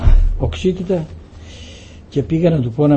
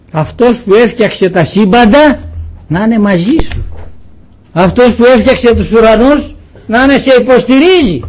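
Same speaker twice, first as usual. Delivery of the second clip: rising, louder preaching climax; preaching at full force; 6 semitones higher, 6 dB louder than usual. Acted. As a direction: build it up to a ringing proclamation. Preaching climax on God's might. (κήρυγμα με πάθος, όλο και πιο δυνατά)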